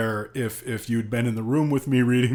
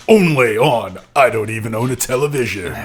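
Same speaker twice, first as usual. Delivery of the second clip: mock announcer; 2 semitones lower, 5 dB louder than usual. Mock announcer tag line.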